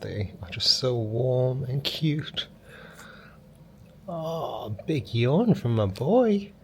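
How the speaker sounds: high, slow cooing over the pup. Real, not acted. Owner cooing over her yawning puppy in a baby voice. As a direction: gush like talking to a newborn. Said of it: "my baby voice on the internet".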